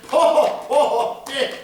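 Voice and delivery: plain reading voice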